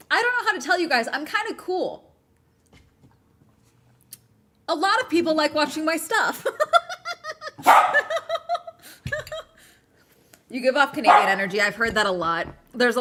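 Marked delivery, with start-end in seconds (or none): 0.1-6.4 high and loud fake swagger, laughing at the end; mock bragging. Jokingly boasting to chat. 6.5-9.4 loud high burst of real laughter. Real, not acted. Laughs at own brag.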